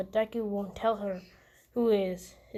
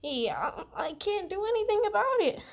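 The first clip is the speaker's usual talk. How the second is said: screaming; 11 semitones higher than usual. Retold or imitated. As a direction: shriek it high at both parents to stop. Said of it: "just screaming".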